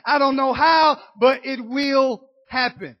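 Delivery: ordinary delivery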